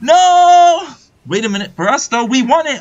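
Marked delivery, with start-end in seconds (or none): 0.0-0.9 loud, high drawn-out wail; long anguished "no". Real, not acted. Gamer watching the fight go wrong, drawn-out yell. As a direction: stretch the no into a big howl. Cries out at disaster.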